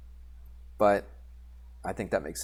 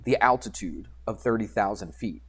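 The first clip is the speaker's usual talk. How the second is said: ordinary talk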